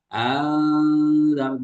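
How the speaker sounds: "anzar" said straight with no hamzah, slow; read as anzar. Shown as a demonstration. Teacher showing one reading variant, "anzar" slow without hamzah. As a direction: say "anzar" directly, drawn out. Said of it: "Ada yang baca".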